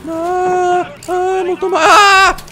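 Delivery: high, drawn-out squeal; frightened wail. Real, not acted. Player caught by a scare, frightened wail. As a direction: rise into a startled scream. Frightened while lifting the lid.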